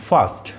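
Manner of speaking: ফাস্ট with a long আ from the front of the mouth; front-mouth vowel. Shown as a demonstration. Teacher contrasting the front vowel. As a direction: keep the আ of ফাস্ট forward, not throaty. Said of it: "এটা গলা থেকে আসছে না".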